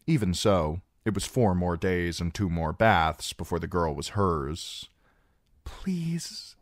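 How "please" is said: breathless pleading whisper. A narrator's reading. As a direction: barely breathe the word please. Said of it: "Cedra finally whispered".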